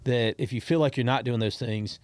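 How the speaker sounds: ordinary talk, nothing marked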